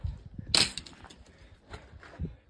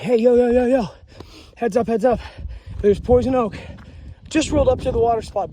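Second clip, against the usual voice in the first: loud, higher warning call to other hikers; calling a heads-up; 3 semitones higher, 4 dB louder, faster than usual. Retold or imitated. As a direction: shout yo yo to a hiker down the trail. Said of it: "Hey, be like".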